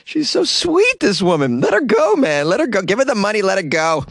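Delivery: high-pitched gushing, Let her go; giddy, pleading on her behalf; delighted squeal. Real, not acted. Gushing over sweet robber granny.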